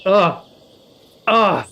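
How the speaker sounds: loud high exasperated ugh; loud grumbling ugh; frustrated groans. Real, not acted. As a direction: groan at the chore. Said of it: "I do have to read it".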